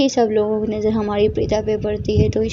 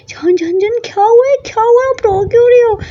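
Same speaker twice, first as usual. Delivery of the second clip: high alarmed cry of concern, voice jumping up; worried alarm; 11 semitones higher, 4 dB louder than usual. Acted. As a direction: rush in frightened for her love. Girlfriend finds partner crying. (घबराई हुई ऊँची आवाज़ में चिंता)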